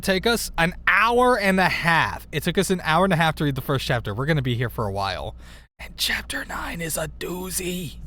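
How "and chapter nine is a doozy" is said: unvoiced whispered aside; whispering. Real, not acted. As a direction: breathe it out as a secret.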